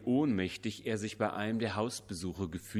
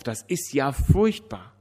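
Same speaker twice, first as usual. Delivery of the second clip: loud, high sobbing outcry; 5 semitones higher, 9 dB louder than usual. A narrator's reading. Widow sobs to the pastor, loud and high. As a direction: sob it out. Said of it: "schluchzte sie auf".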